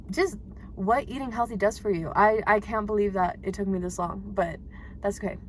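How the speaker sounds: ordinary delivery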